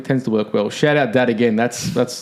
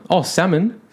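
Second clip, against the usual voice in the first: higher-pitched dawning recognition; acted realisation; 6 semitones higher, slower than usual. Replaying finally understanding partner's word, high realisation. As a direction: let the penny drop, pitched up. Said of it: "I go".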